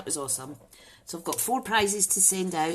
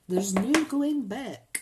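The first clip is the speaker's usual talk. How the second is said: mock dramatic warning; 8 semitones higher, 4 dB louder, much slower than usual.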